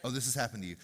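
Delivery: ordinary talk, nothing marked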